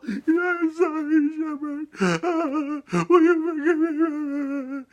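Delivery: very high, slow, sobbing lamb voice; hammed-up tearful lamb plea; crying little lamb. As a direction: blubber an apology, drawn out and squeaky. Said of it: "And Oscar was there crying".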